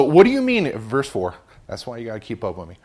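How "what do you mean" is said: high, loud exclaimed question; Nicodemus's loud exclamation, read aloud; exclaiming. A narrator's reading. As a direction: burst out with the question, pitched high. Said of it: "Exclaimed Nicodemus".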